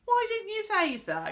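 high, loud, taken-aback question; surprised protest. A narrator's reading. Pixie learns whose friend she is, protesting.

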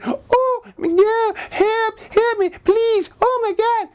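very high squeaky doll voice begging; high squeaky pleading doll; pleading little doll. Acted. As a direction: beg like a tiny toy in danger. Santa doll begs for help.

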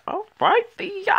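high, loud cheer; all right Theo, yelled at the screen; excited shout. Real, not acted. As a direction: hype him up like a fan. Cheering the character on.

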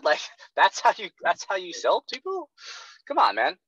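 ordinary delivery

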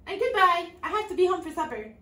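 high, loud and fast; hasty princess. A narrator's reading. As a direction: rush off with a brush-off. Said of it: "said the princess".

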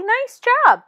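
teacher gushing before correcting Sally; bright praise. Acted. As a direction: gush over the report first.

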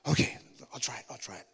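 hushed, hesitant agreement; nervous whisper. Retold or imitated. Scared new believer agreeing to witness, in a nervous whisper. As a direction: barely voice it, scared to death. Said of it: "I was so nervous".

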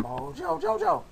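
slow, low villain voice; a hint of a cartoon villain; Mojo Jojo voice.

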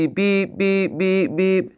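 flat electronic beeping; microwave beeps. Pretend. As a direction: beep like a microwave timer. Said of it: "wanna hear my impression of a microwave".

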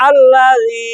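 আল্লাযী with a soft dh in যী, long held; drawn-out আল্লাযী with gentle "dhee"; soft dhal. Shown as a demonstration. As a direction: recite আল্লাযী slowly, tongue tip out on যী. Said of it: "লাল যেন জিহ্বার আগা থেকে নরম ভাবে".

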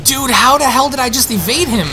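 high, loud amazed shout; astonished loud outburst; excited disbelief. Real, not acted. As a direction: yell it out in relief. Escaped the monster.

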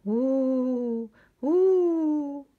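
high rising ghostly wail; ghostly hoot. A narrator's reading. Spooky noises outside the tent at night, ghostly hooting. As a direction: moan like a ghost.

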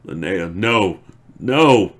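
anguished shout of no; despairing scream. Acted. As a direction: scream the refusal out of grief. He screams over her body.

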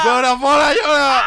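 very loud, high celebratory yell; cheering shout. Real, not acted. Friend egging on the crying winner, cheering shout. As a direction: roar encouragement at full voice.

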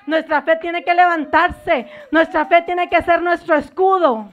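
loud, driving preacher's cry; preaching at full force. Acted. Pastor warns the congregation of demons, preaching at full force. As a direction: hammer each line home to the church. Preaching that faith must rise.

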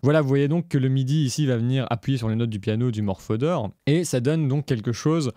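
usual speaking voice

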